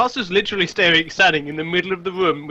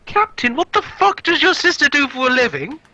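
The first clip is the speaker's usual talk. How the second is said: pitched-up shout to the next room; 7 semitones higher than usual. Acted. Castor to the captain in the bedroom, high shout. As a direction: yell it toward the bedroom. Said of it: "gonna shout in the direction".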